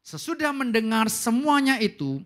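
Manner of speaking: loud, slow group reading; reading aloud loudly. Shown as a demonstration. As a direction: read it out strong and deliberate. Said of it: "Dengan keras".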